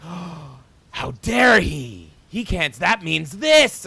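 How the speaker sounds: high, loud, slow scandalized whine; mock outrage. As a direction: parody a pearl-clutching internet critic. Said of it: "people on the internet that'll be like".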